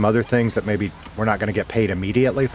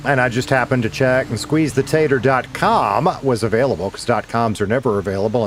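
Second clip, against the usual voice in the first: ordinary talk, nothing marked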